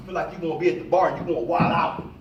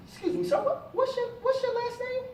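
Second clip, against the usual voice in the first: put-on much higher eager girl's voice; imitating a young lady. Speaker voices a schoolgirl asking his last name, high and eager. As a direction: ask shyly and eagerly, pitched up.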